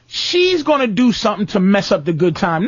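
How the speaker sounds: ordinary delivery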